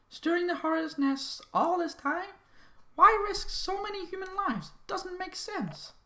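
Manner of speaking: high, puzzled questioning voice; character voice. Acted. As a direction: voice a baffled companion. Voices game character dialogue.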